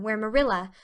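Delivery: plain reading voice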